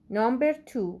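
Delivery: ordinary talk, nothing marked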